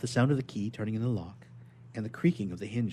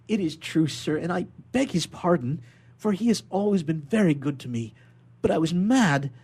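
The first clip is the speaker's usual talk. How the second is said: higher, louder earnest admission; earnest confession; 7 semitones higher, 8 dB louder than usual. Acted. Prisoner confessing to the governor, higher and louder. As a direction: own up to it with feeling. Prisoner admits his fit of madness.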